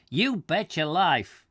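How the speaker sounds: drawn-out American "you bet your life"; the American tourist agreeing eagerly, slow; American accent. Acted. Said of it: "said the American".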